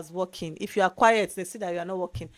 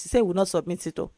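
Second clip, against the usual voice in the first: ordinary talk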